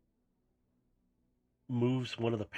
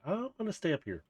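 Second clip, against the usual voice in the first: higher, lightly voiced little robot; a small bot perched and playful; little minicon voice; 8 semitones higher than usual.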